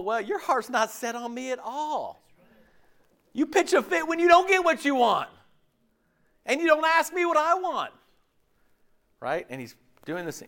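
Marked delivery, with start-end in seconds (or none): 3.3-7.9 pleading complaint. Acted. Preacher voicing God's complaint.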